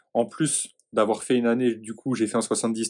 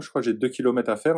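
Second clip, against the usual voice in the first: usual speaking voice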